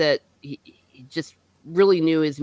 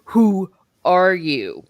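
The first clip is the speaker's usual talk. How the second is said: insistent demand; 6 dB louder, much slower than usual. Retold or imitated. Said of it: "But he's like".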